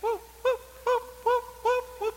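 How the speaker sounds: high-pitched spooky "woo" noises; repeated high hoots, mock-mystical; playful magic woo. Jokes that it's magic.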